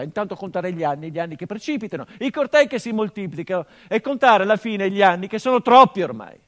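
building to a loud, high cry of frustration; rising outburst. Acted. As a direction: let the anger swell until it bursts on troppi. Monologo che cresce in sfogo. (sfogo che cresce fino a gridare)

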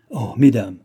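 loud cry falling away; exclaimed appeal. A narrator's reading. Duchess to the king's three daughters, exclaimed appeal. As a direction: call out to them in appeal. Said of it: "s'écria la duchesse".

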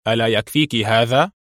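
plain reading voice

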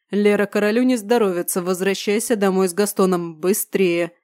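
plain reading voice

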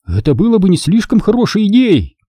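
a touch of nervous caution; uneasy voice. A narrator's reading. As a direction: interrupt with mild unease. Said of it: "опередил беспокойный голос за его спиной".

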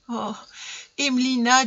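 a touch of tears in the appeal; tearful. A narrator's reading. Mother kneeling before her daughter, tearful. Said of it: "d'une voix pleine de larmes".

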